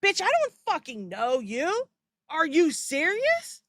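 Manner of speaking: normal talking voice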